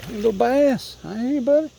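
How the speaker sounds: high, excited call to a little fish; high-pitched fish talk. Real, not acted. Greeting a fish being landed.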